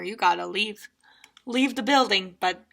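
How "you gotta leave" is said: usual speaking voice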